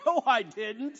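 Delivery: high, loud gloating devil, laughing through it; smug Satan. Retold or imitated. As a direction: crow about the clever plan.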